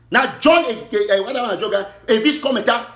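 ordinary talk, nothing marked

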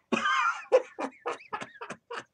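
high-pitched clown giggle. Acted. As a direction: squeal a little clown giggle. Clown cackle.

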